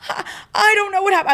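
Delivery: high, loud, flustered panic; panicking. Retold or imitated. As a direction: blurt it out, rattled and panicking. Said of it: "I, like, started to panic".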